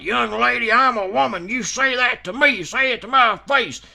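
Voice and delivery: hammed-up feisty old lady; imitating angry mama.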